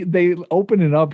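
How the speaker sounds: ordinary talk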